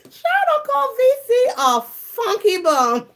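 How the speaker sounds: high squeal of shocked delight; squealing disbelief. Real, not acted. Reading a chat comment from Cheryl, a high squeal of delight. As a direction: shriek it in giddy disbelief.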